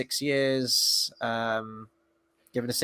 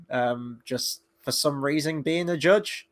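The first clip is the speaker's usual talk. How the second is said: ordinary talk, nothing marked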